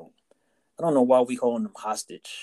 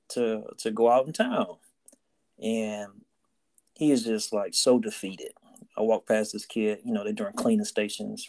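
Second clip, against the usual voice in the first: normal talking voice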